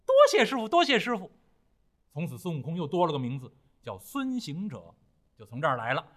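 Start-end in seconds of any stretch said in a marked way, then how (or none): 0.1-1.3 高亢响亮，连声道谢; 孙悟空得了新名，高声欢喜地谢师傅; 欢喜道谢. Acted. 孙悟空得名后谢师傅. (又高又亮地欢喜道谢)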